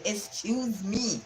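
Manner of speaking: slow, high, haughty excuse me; with attitude. Retold or imitated. Girl cutting off her boasting father, with attitude. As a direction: put him in his place with a slow cold excuse me. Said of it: "when the child said that with attitude".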